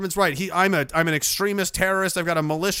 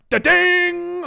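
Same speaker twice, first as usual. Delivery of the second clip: sound effect; 11 semitones higher, 5 dB louder than usual. Retold or imitated. Imitated hit sound, high.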